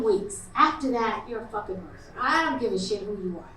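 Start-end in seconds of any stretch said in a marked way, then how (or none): none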